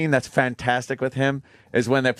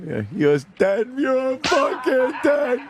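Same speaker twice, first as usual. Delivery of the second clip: gleeful laugh; 8 semitones higher than usual. Retold or imitated. Gleeful quote of De Niro.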